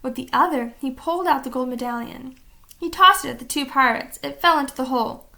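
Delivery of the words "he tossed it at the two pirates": usual speaking voice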